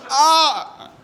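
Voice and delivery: short high wail; high-pitched "ah"; newborn's cry. Acted. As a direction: let out a high mock baby cry. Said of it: "guy born with sunglasses on".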